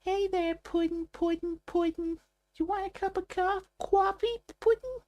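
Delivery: very high-pitched cartoon New York squeal; New York mouse in a cartoon; bad New York accent. Shown as a demonstration. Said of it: "trying to do a New York accent".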